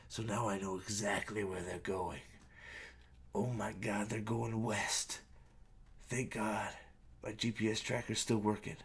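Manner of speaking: soft, low mock-villain musing; understated parody of a relieved villain; lightly voiced villain. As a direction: murmur it low, as if to himself. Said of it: "And he's like".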